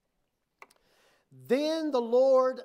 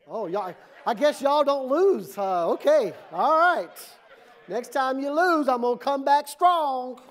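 louder, higher mock challenge; loud playful teasing; 5 semitones higher, 8 dB louder than usual. Real, not acted. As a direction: rib the rival fans, full voice. Teasing rival fans, loud.

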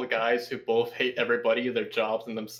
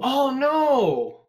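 loud, high-pitched "oh no" of dismay; mock dismayed cry; 11 semitones higher, 6 dB louder than usual. Friend told Hentai Heaven has fallen, mock dismay.

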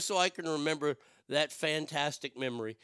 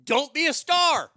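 loud, high bark; loud punchline; 9 semitones higher, 7 dB louder than usual. Real, not acted. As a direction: yell it as the obvious fix. Rant punchline.

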